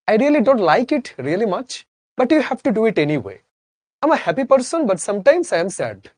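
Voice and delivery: slow, high, mock Indian accent; a playful imitation of an Indian accent, drawn out. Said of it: "That is Indian accent". (ধীরে, উঁচু গলায় Indian উচ্চারণে নকল)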